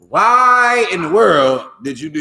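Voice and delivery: WHY high, loud and stretched, then coming down; high-note question. Shown as a demonstration. Teacher demonstrating inflection to the class, high questioning WHY. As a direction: hit WHY high and long, then descend through the question. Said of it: "Then you come back down".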